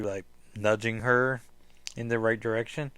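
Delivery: ordinary talk, nothing marked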